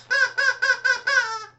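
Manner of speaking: very high squeaky puppet cackle; a bird puppet's shrill chuckle; high cartoon bird laugh; puppet squawk laugh.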